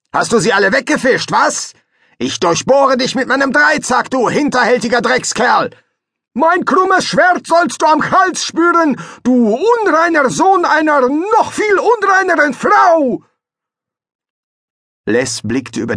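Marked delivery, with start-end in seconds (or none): 0.1-5.7 fast, high and loud abuse; angry insults. Acted. Fester hurls threats at the rival, fast, high and loud. Quarrel between rival fishermen. 6.3-13.2 very high, loud shouted threat in a different voice; foreign rival's threat. Acted. The rival threatens Fester with his sword, very high and loud.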